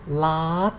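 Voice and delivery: lad gliding gradually upward; long rising lift on lad; high-tone demo. Shown as a demonstration. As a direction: bend lad up toward the end. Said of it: "it is the high tone".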